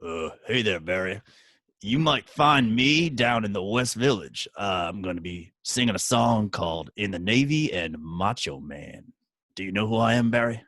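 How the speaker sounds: deep, soft hammed-up tough guy. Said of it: "I'm gonna do an impersonation of a famous person with a mustache".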